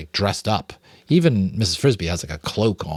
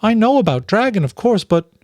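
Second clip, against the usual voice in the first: high, bewildered mother mouse; Mrs Frisby puzzled; 12 semitones higher, 4 dB louder than usual. Acted. Mother mouse to the rats, high and bewildered. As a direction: sound lost, pleading to understand.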